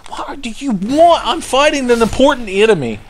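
high, whining protest at an interruption; exasperated complaint. Pretend. Snaps at interrupting enemy.

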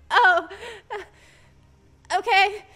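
loud laughing oh, then okay; fake-accepting it with a nervous laugh; forced okay. Customer pretending to accept the guy's excuse, nervous laugh.

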